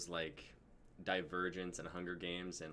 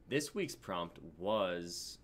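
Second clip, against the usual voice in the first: very slow, drawn-out build-up; suspense drumroll; much slower than usual. Pretend. Host teases who suggested the prompt, slowly. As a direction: stretch every word to tease the reveal.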